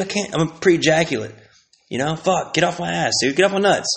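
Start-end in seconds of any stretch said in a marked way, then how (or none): none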